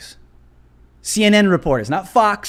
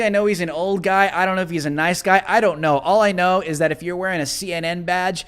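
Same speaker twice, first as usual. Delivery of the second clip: ordinary talk, nothing marked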